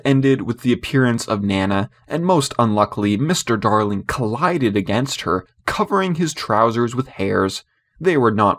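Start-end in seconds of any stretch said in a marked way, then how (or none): none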